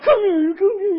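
put-on high, loud baby talk; hammed-up pet-owner voice; cooing at a pet. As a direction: call the dog over in an excited squeal. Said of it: "do crazy things with your pets".

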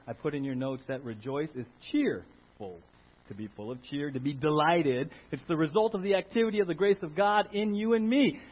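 ordinary delivery

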